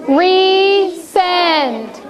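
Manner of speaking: RESEND stretched into long RE and SEND; drawn-out syllables. Shown as a demonstration. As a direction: lead a class chant of RESEND, very slowly. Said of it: "Everybody's gonna say it with me".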